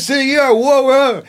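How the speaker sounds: very high, loud excited whoa; ad excitement. Shown as a demonstration. Actor mimicking the Domino's ad, high and loud. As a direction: shout it like a hyped pizza ad. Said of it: "advertisement for Domino's Pizza".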